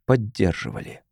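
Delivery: ordinary delivery, nothing marked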